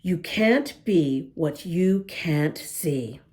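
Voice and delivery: usual speaking voice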